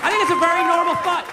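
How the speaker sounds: emphatic. Real, not acted. Loud emphatic start.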